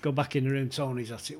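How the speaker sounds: usual speaking voice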